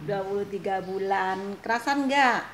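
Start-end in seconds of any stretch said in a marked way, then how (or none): 1.6-2.4 louder, higher probing question; raised-voice question. Real, not acted. Vlogger asking her friend about settling in, raised voice. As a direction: ask it brightly, pitch falling at the end.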